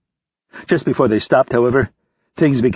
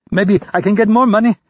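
much higher, louder, eager hope; hopeful; 11 semitones higher, 4 dB louder than usual. A narrator's reading. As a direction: grasp at a chance, eagerly. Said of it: "Rapp said hopefully".

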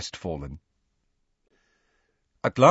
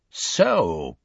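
a drawn-out, lower, nasty "so"; nasty inflection; 3 semitones lower than usual. A narrator's reading. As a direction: say it with a nasty edge. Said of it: "with a nasty inflection in his voice".

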